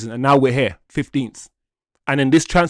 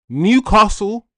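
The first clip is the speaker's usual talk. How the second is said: calling out; 6 semitones higher, 6 dB louder, slower than usual. Real, not acted. Pleading call to Newcastle.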